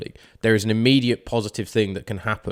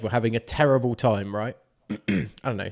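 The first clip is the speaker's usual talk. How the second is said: ordinary talk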